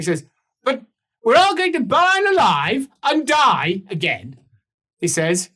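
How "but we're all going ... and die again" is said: high, loud grumpy bargeman; Lucas voice. Acted. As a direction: whine it out as a put-upon captain. Lucas protests.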